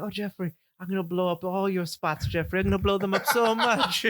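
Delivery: high, teasing put-on girlfriend voice; joking mock Sandy. Host jokes as Sandy in a high, teasing voice. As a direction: ham up a lovesick high voice as a joke. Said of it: "I was, like, joking".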